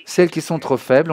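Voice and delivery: ordinary delivery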